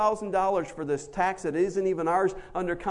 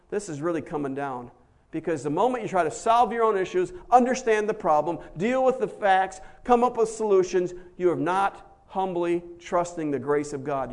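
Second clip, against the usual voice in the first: usual speaking voice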